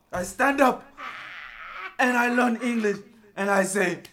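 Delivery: mock African accent. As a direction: rise into a defiant shout in accent. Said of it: "you can do an accent".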